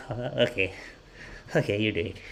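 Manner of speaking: softly, reluctantly giving in; imitated meek mom. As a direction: play the mom quietly yielding. Mom gives in to loving nurse.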